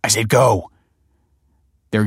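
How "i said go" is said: louder, impatient dismissal; raised, cross voice; stern order. A narrator's reading. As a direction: send the child away firmly. Father snaps at his son.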